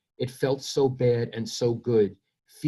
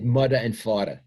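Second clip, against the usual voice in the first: Brooklyn accent, mudda and fada with th turned to d; 3 dB louder than usual. Shown as a demonstration. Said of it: "I said mudda and fada".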